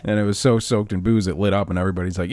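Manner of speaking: ordinary delivery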